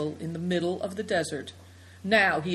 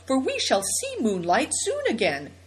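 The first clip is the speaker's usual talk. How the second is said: glad cry; 6 semitones higher than usual. A narrator's reading. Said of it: "cried Seven Stars".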